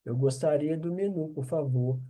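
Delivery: normal talking voice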